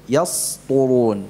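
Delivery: normal talking voice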